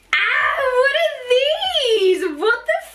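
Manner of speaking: high, slow, drawn-out squeal of delight; excited squeal. Real, not acted. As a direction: let it out as a thrilled cry. Opening the box, excited reaction.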